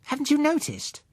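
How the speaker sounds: put-on high, incredulous child question; can't believe you missed it; surprised girl. Girl Susan astonished at Bill, high incredulous child voice. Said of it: "she said".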